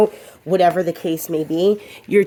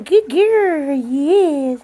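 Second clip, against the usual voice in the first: much higher sing-song praise; dog praise; 8 semitones higher, much slower than usual. Real, not acted.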